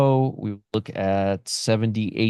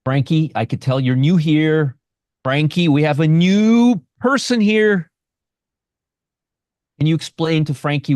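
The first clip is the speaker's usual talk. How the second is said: teasing; 5 semitones higher, 4 dB louder than usual. Real, not acted. Teasing a new viewer.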